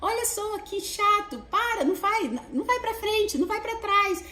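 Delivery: high, fast, mocking little voice; nagging inner voice. Inner critic taunting the speaker, high and mocking. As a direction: needle yourself in a whiny high voice. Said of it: "vem aquela vozinha".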